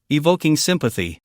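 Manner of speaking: plain reading voice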